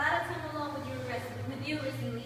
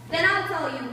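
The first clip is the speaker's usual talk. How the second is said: stern; 2 semitones higher, 8 dB louder than usual. Acted. Polonius lecturing his daughter.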